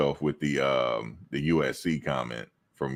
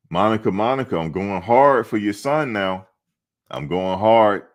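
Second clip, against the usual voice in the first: louder, higher call; 5 semitones higher, 6 dB louder than usual. Real, not acted. Fan calling out to the player's mother, loud and high. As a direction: call out to Monica with gusto.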